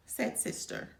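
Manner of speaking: ordinary delivery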